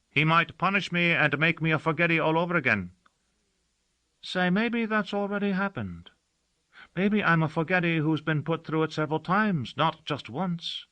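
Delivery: uneasy, then wondering aloud; a servant musing he may have been made forgetful before; servant Gossagor's voice. Acted. Servant fears punishment.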